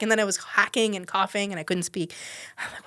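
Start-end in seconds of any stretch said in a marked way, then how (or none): none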